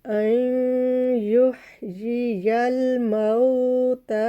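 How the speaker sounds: very slow chanted recitation; tajweed recitation. Shown as a demonstration. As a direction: hold each vowel long as in Quran reading. Recited verse.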